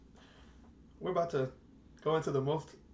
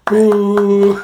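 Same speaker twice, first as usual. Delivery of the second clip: playful boo; 5 semitones higher, 18 dB louder than usual. Acted. Booing the guest for fun.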